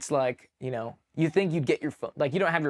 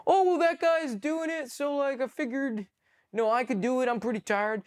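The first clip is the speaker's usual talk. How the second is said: much higher, put-on excuse-making voice; whiny new private; 11 semitones higher than usual. New private excusing himself to his leader, high mock whine. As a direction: imitate a sheepish rookie in a high voice.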